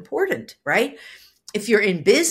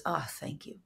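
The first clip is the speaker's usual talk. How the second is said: a softer "ah, thank you" with depth; deep grateful thanks; 4 semitones lower, 14 dB quieter than usual. Shown as a demonstration. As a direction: breathe out a heartfelt "ah, thank you". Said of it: "there's more depth".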